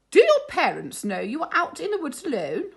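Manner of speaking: high, loud stern telling-off; a strict teacher scolding runaway cubs; firmest voice. Acted. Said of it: "in her firmest voice".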